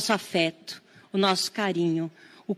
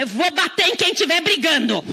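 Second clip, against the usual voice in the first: threatening parent; 7 semitones higher than usual. Retold or imitated. Parent threatening two fighting kids, raised mock threat. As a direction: play the fed-up parent threatening. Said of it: "Aí você fala".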